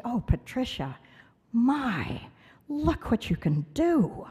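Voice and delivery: imitating a teacher's wonder, drawn out between pauses; admiring teacher. Teacher marvels at child's drawing.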